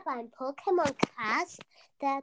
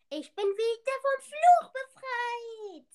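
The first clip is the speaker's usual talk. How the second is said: excited genie; 9 semitones higher, 3 dB louder than usual. Pretend. Genie freed from pirate curse.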